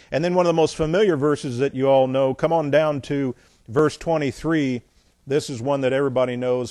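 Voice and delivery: ordinary talk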